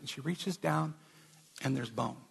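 ordinary delivery

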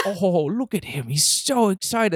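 high, slow, delighted British presenter; slow British delight over the bird; British accent. Retold or imitated. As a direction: gush like a British nature host. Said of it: "He has, like, a British accent".

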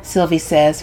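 ordinary delivery, nothing marked

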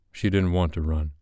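ordinary delivery

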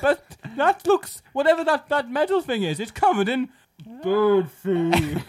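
high, slow puzzled cartoon squeak; high, slow mock bewilderment; cartoon sidekick voice. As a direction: puzzle over the trap like a dim cartoon sidekick.

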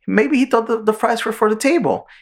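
high, lightly voiced calm Bugs; a hint of reasonable cartoon rabbit. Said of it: "And Bugs like".

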